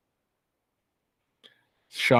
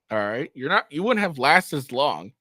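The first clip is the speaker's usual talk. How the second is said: ordinary talk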